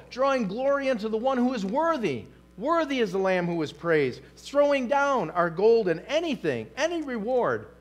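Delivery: normal talking voice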